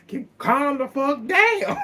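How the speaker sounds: slow, laughing mock yell; imitating Courtney yelling, slow and laughing. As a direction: drag it out while cracking up.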